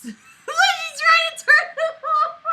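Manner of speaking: high, loud laughing squeal, slowed. Real, not acted. Laughing at the cat as she squirms on him.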